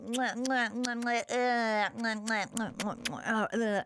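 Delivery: smacking lips like a voice actor faking kisses; mimicking a lonely studio kiss; mock kissing noises. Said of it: "a voice actor in a sound booth go".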